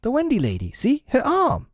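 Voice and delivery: very high, astonished exclamation; excited cry. A narrator's reading. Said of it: "he cried".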